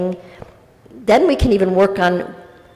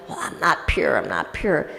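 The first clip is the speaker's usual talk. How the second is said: low, soft mock self-reproach; self-doubting; 5 semitones lower, 7 dB quieter than usual. Soul fretting over falling short of its best, quietly self-doubting.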